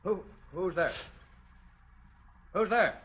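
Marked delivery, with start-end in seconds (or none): 2.5-3.0 high, sharp call of alarm; startled challenge. Acted. Startled man challenging someone unseen in the house, alarmed. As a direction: call out high, demanding an answer.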